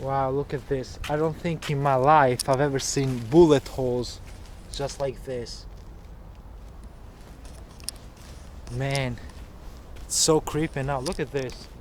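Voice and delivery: low, quiet, uneasy voice; real unease, hushed; hushed and scared. Real, not acted. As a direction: drop the voice as if spooked. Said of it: "I got scared a little bit".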